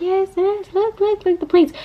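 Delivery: high-pitched imitation of an excited child; excited little girl. Mom imitating her daughter answering her brother, excited. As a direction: play the sister joining in eagerly. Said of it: "they were both, like, laughing".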